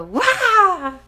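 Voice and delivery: a loud high mock baby cry; imitated newborn wail. Mother mimics newborn crying at her husband, loud and high. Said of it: "She actually cried".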